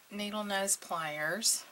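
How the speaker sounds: normal talking voice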